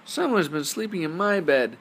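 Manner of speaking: high, loud squeaking cub; Baby Bear's squeak. Acted. Baby Bear finds his bed used, high squeak. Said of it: "squeaked in his tiny little voice".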